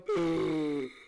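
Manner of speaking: gasp. Retold or imitated. Realizing the chips are burning.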